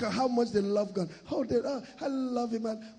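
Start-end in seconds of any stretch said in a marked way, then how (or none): 1.3-2.6 mock gush. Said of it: "Come and see the way they talk".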